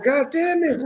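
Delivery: high, squeaky groan; exasperated. Retold or imitated. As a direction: cringe at yourself. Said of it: "I'm like".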